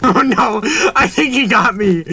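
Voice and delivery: giggling through the words, high; laughing in disbelief. Real, not acted. Laughing as he's caught.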